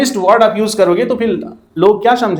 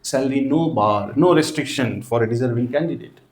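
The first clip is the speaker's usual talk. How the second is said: very slow and softer, lower, word by word; quiet slow dictation; 8 semitones lower, 6 dB quieter, much slower than usual. Shown as a demonstration. Teacher repeating the ad line for students, softer and very slow. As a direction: murmur the line slowly as students write it.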